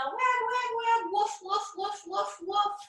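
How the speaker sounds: high sing-song wag and bark; playful puppy. Pretend. Teacher cheering the kids on in puppy wag, high sing-song. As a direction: chant it like a bouncy puppy.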